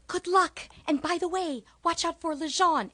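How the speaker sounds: high, loud frantic warning; panicked colleague. Retold or imitated. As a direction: blurt it out in a panic and run. Panicked teacher fleeing the class.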